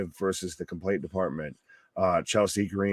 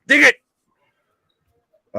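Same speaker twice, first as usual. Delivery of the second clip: mock hype; 10 semitones higher, 11 dB louder than usual. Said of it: "says Bobby".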